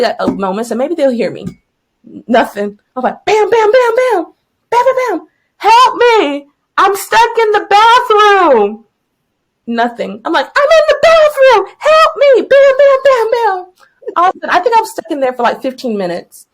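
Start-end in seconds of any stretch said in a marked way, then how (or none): 3.2-8.8 high banging noises into a loud holler; retold banging and yelling. Trapped teacher banging on the door, mock desperate yelling. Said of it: "I was yelling and crying". 10.5-13.7 very high, loud holler, punching each bam; retold frantic yelling. Teacher stuck in the bathroom, hammed-up frantic yell. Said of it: "I was yelling and crying".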